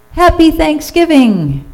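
very high, sung-out greeting; cheerful announcement. A narrator's reading. Turkey in disguise at the door, calling cheerfully. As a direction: sing out a holiday greeting.

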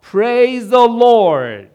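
very loud, high, long-drawn cry; joyful praise shout. A narrator's reading. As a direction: shout slow, soaring praise. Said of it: "caused her to shout".

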